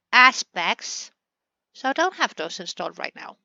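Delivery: normal talking voice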